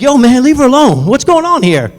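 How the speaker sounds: high, loud confronting shout; acted-out indignant intervention; angry rescuer. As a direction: shout down a grabber in the street.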